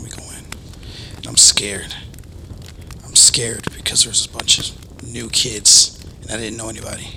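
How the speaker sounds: whispering a scary tale to friends; spooky story whisper. Acted. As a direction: draw the listeners in with a whisper.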